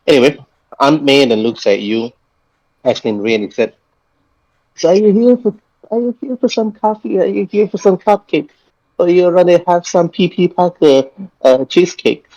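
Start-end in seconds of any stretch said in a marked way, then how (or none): 4.8-12.3 put-on high sweet old lady. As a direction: play a friendly old cafe owner. GM voices Aunt May again.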